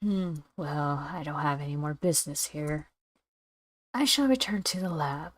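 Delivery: low, soft, detached doctor; reserved doctor. Acted. Doctor excusing self to the lab, reserved and quiet. Plays withdrawn doctor in game.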